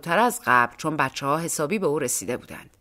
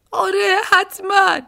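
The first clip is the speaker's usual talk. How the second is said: much higher, louder woman's voice; distraught woman; 13 semitones higher, 7 dB louder than usual. A narrator's reading. Woman answering the policeman, high and upset. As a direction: snap yes, pitched way up.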